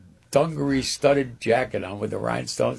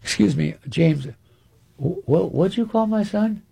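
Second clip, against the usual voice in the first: higher, puzzled father voice; father asking what Cotton called his son, higher; surprised father; 9 semitones higher than usual. Retold or imitated. Father questions Cotton at dinner, mild mock surprise. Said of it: "My father goes".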